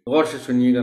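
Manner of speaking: normal talking voice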